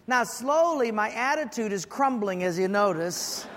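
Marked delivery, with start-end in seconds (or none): none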